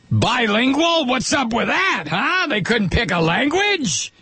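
high, indignant mock outrage at Canadians; mock suspicion. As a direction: rant like a suspicious patriot, tongue in cheek.

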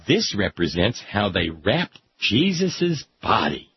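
normal talking voice

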